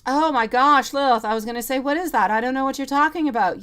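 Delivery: loud, high, laughing surprise; surprised excitement. Real, not acted. Tarot reader reacts to a viewer's German message, loud and surprised.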